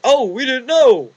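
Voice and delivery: high, loud mocking whine; mock innocent officials. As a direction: sneer like a caught politician. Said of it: "it's like".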